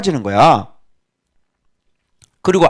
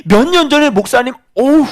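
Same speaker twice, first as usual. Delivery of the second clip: surprised church lady; 10 semitones higher than usual. Retold or imitated. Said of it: "우리 성도들이 깜짝 놀래요".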